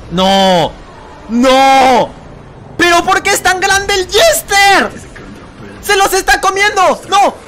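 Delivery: high, loud panicked cries; high-pitched wail of alarm; panicked shouting. Real, not acted. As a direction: yell in horror at the game. Game panic as monster eats characters.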